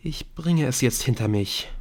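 usual speaking voice

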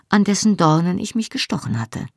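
usual speaking voice

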